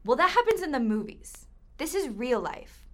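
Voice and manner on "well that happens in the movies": mocking dismissal. Captor mocks victim's worry.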